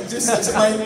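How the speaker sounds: normal talking voice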